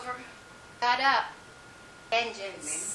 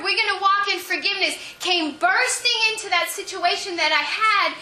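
raised, fervent preaching voice; 5 semitones higher than usual. Acted. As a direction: preach it with building fire. Preaching builds.

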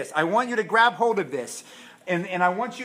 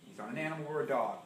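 ordinary delivery

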